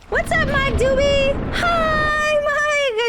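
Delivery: high, loud shouted welcome; excited greeting. Real, not acted. Streamer greets a chat viewer, high excited shout. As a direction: hail a friend across the room.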